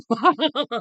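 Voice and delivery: loud, high laugh; burst of laughter. Real, not acted. Streamer laughing at his own Christmas alphabet joke, loud laugh. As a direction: crack up at your own joke.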